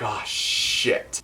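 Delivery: a hushed, unvoiced curse to yourself; soft whispered oh shit; whispered dismay. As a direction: whisper oh shit under your breath. Sent back again, quiet dismay.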